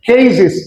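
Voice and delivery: কেজিস ending in "-ziz"; new "-iz" ending. Shown as a demonstration. Teacher showing the corrected way, কেজিস with "-ziz". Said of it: "এখন বলবো আমরা কেজিস".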